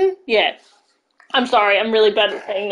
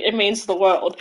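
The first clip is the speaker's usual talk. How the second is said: ordinary talk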